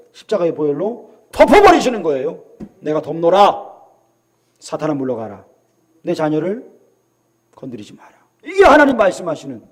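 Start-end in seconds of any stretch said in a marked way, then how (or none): 2.8-8.1 low, quiet, measured command; voice of God. Acted. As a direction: order Satan away with hushed authority. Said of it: "하나님 음성인 거예요". (낮고 조용하게 명하는 하나님의 음성)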